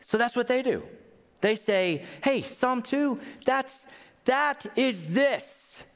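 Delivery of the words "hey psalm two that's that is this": high, slow, wondering proclamation; excited discovery. Retold or imitated. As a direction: lean in, amazed it all connects. Early church's excited realization.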